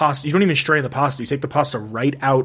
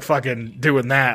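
normal talking voice

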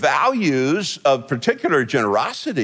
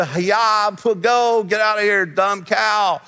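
higher-pitched, half-hearted cowboy holler; mock cattle-driving call; 4 semitones higher, slower than usual. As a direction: play at shooing cattle along. Acts out driving cows from behind.

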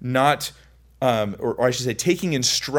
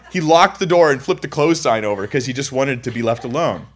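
ordinary talk

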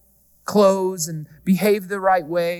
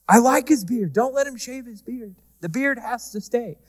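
imitated wife's higher-pitched insistence; Jessa protesting; 6 semitones higher than usual. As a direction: plead higher, mimicking a protective wife. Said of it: "Jessa yells".

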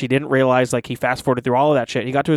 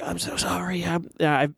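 soft, higher, strained mock apology; weak hushed pretend plea; feeble apology; 4 semitones higher, 6 dB quieter than usual. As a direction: imitate a dying man struggling to apologize. Imitating dying father's apology.

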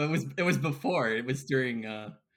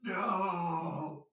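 mock panic, crying out no; hammed-up terrified cries; much slower than usual. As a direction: ham up the horror of transforming. Cursed transformation acted out.

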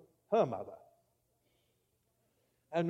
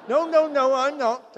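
quick protest; 7 semitones higher, 5 dB louder than usual. Real, not acted. Preacher protesting to congregation.

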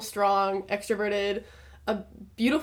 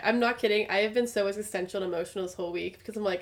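ordinary talk, nothing marked

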